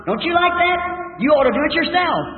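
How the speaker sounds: high-pitched, retold exhortation; excited urging. Worshiper urging a pine squirrel to join in, excitedly. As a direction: egg the listener on in a high voice.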